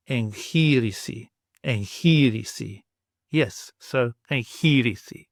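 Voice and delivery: enchirisi slowed, NGH in the middle; slow Greek pronunciation. Shown as a demonstration. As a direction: draw out enchirisi slowly. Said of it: "the kind of ngh sound".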